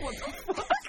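very high shocked laugh; surprised squeal. Real, not acted. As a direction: squeal in disbelief. Laughing disbelief.

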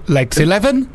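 lightly voiced bingo call; bingo caller. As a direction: call the numbers like a bingo hall. Imitating bingo calls.

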